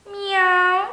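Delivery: high, loud pitiful kitten meow; despairing meow. A narrator's reading. A lost kitten crying out between the trees, pitiful meow. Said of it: "rozpaczał ktoś między drzewami".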